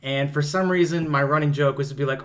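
usual speaking voice

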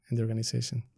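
ordinary talk, nothing marked

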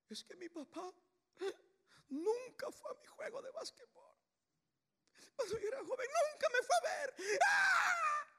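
high, wailing mock sob of a grown man; mock crying. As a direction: ham up a whiny crying son. (llanto exagerado y burlón)

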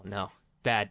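usual speaking voice

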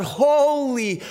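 slow, ringing proclamation of holy; slow proclaimed holy, holy, holy; proclaimed praise. Acted. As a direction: cry it out like the angels in worship. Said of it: "and cry out".

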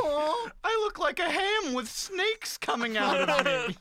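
very high, loud honking goose voice; goose impression. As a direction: squawk it out like an angry goose. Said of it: "that was an amazing goose impression".

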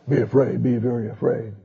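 low, soft lion voice; mock lion threat, low and hushed. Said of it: "The lion says".

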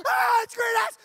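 loud high terrified yell; panicked scream. Retold or imitated. Waking up in the tent, panicked scream. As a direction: scream like the monster's here. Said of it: "And I'm like".

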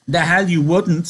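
lightly voiced scornful rabbit; mildly scornful character retort. A narrator's reading.

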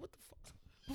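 barely voiced baffled aside; hushed disbelief. Retelling the radio spot, hushed disbelief. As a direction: say it almost under your breath.

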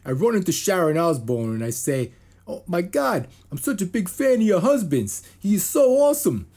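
high, loud gushing fanboy voice; mock Matt LeBlanc. Quoting LeBlanc gushing to Sharon, high and loud. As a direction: gush like a starstruck actor. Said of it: "so here's Matt LeBlanc".

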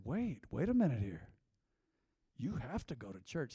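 low, hushed, grave retelling; mock serious. Imitating the TV husband to his fed-up wife, quietly serious. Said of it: "He got real serious".

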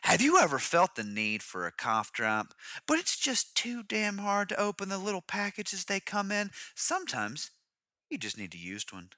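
low, soft ad testimonial voice; mock commercial read. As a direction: sell it softly like a spoof advert. Mock cough drop ad.

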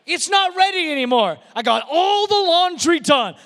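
high-pitched homemaker's complaint; hammed-up household despair; mock exasperation. Preacher voicing a homemaker after everybody showed up, mock-exasperated.

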